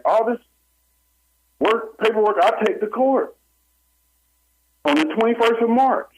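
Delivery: excited. Real, not acted. Building to the court date.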